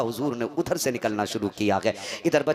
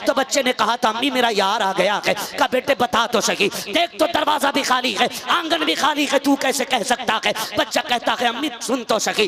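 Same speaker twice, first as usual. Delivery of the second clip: louder, urgent preaching voice; heated preaching; 8 semitones higher, 7 dB louder than usual. Acted. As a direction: drive the scene with fervour. Rising preaching dialogue. (ऊँची आवाज़ में जोशीली तक़रीर)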